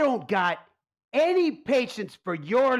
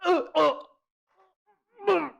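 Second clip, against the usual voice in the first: choking stammer; 7 semitones higher, much faster than usual. Acted. Mob boss having heart attack.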